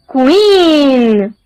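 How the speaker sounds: ordinary talk, nothing marked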